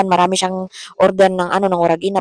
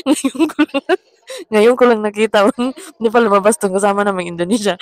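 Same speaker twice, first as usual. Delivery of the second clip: laughing; 2 semitones higher than usual. Real, not acted. Vlogger spotting an unexpected housemate in her footage, laughing. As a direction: crack up mid-sentence. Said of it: "tang tawa talaga ako dito".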